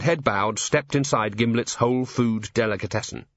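plain reading voice

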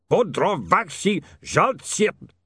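slow, drawn-out foreign curse at a broken jar; Ubervaltian curse. Acted. Otto cursing after knocking things over, slow and foreign. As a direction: spit out the curse slowly in a thick accent. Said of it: "some cursing in Ubervaltian".

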